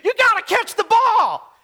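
dad shouting at the players; yelling at the TV. Retold or imitated. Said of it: "he will yell at the T V".

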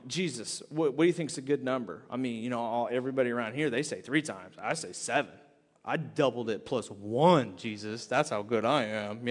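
low and pleased with himself, fishing for praise; low sly bragging disciple; boastful Peter. Acted. Voicing Peter bragging to Jesus.

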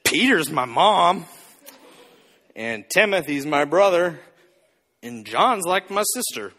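low, halting tipsy drawl with long pauses. Retold or imitated. Jesus naming disciples as family, low mock-drunk drawl. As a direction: count them off like a woozy pirate. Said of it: "Jesus is like Jack Sparrow for a minute".

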